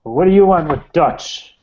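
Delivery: loud, slow hostile challenge; menacing demand. Acted. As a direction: growl it at the stranger. Local confronts the stranger.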